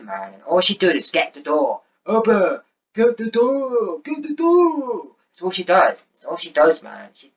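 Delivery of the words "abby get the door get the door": shrill-free high mocking nag, slow; mocking Abby. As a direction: drag out a high whiny mimic of her yelling. Mocking Abby's nagging.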